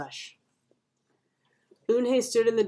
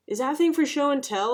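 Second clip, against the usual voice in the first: put-on higher, inquisitive kid's question; curious boy; 7 semitones higher, 3 dB louder than usual. A narrator's reading. Boy asks Eunhae about her pouch, curious. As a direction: ask it lightly as a curious boy.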